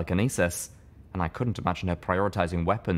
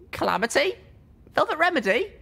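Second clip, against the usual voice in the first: high, loud calls for friends; calling out; 3 dB louder than usual. Acted. Littlepip calling for her missing companions, loud and high. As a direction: call out into the empty room, searching.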